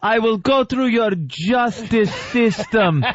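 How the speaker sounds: high, smug movie villain taunt; foreign bad guy speech. Mock villain taunting the cop at gunpoint. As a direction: gloat like a cornered foreign villain. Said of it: "the bad guy starts his speech".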